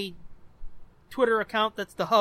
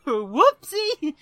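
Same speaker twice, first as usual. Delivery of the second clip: high, loud cutesy oops; playful whoopsie; 6 semitones higher, 5 dB louder than usual. Pretend. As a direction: squeal it in mock embarrassment. Mock apology for forgotten link.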